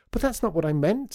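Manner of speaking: put-on high, loud child's protest; upset protest. A narrator's reading. Child protests when accused.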